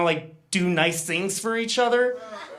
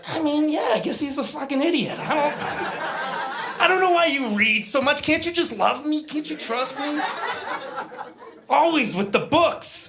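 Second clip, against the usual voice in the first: higher, laughing desperate deflection; defensive rant; 8 semitones higher than usual. Retold or imitated. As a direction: blame her reading to dodge the question.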